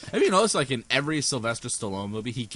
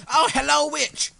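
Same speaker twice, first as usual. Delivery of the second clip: startled hello; 12 semitones higher, 6 dB louder than usual. Real, not acted. Greeting a witch in game.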